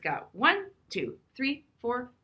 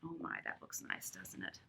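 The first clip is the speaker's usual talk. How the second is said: whisper; 13 semitones lower, 18 dB quieter than usual. Real, not acted. Aside about a picture.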